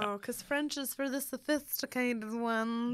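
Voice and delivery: slow, put-on snob. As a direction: draw it out with mock sophistication. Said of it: "that was my snob voice".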